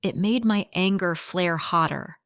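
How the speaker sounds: ordinary delivery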